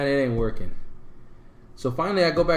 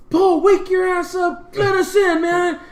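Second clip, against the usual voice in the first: much higher, loud, slow shout; yelling through door; 7 dB louder, slower than usual. Retold or imitated. Kicking the door to wake drunk Paul, furious yelling.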